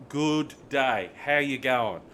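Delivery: loud, careful word-by-word greeting; retold slow, over-clear greeting. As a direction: spell out "Good day, how you going" for a baffled foreigner. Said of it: "so I had to slow down".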